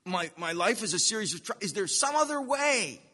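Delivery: high, whiny plea rising at the end; pleading believer. Acted. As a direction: beg for another way out. Voicing believer pleading for escape.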